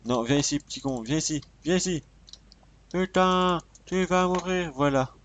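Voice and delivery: high-pitched, exasperated calling; agacement en pleine chasse. Real, not acted. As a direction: yell after a quarry that keeps escaping. Chasing a mob in game. (énervé, voix qui monte dans les aigus)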